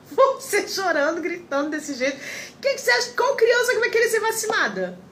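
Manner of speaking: high, scolding nurse voice. Retold or imitated. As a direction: tell her off like an exasperated nurse. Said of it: "ela falou".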